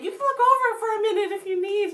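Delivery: high, loud director voice. Retold or imitated. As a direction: play the kindly director. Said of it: "they're like".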